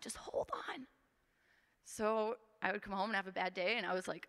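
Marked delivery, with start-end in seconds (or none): 0.0-0.9 mom's higher, hushed whisper; whispered comfort. Retold or imitated. Mom whispering to her bullied child to hold on. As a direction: breathe it out softly like a mother's plea. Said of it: "she's like".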